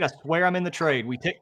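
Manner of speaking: usual speaking voice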